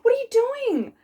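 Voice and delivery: high startled question; alarmed customer. Retold or imitated. Piercer imitating a nervous customer, high and alarmed. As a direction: squeal it like a frightened client. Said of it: "they go".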